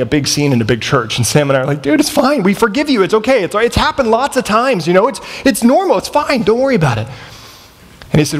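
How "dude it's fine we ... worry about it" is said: high-pitched, brushing it off; hasty reassurance. Retold or imitated. Two homeless men forgiving the big guy, awkwardly reassuring. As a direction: placate him, pitched well up. Hastily reassuring the apologizing man.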